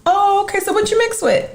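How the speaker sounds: very high-pitched mock questioner; put-on high voice, prying; nosy questioner. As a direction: imitate a nosy stranger in a squeaky-high voice. Acting out nosy people's question.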